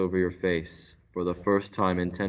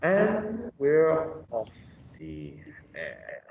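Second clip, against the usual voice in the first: fading; 6 semitones higher, much slower than usual. Acted.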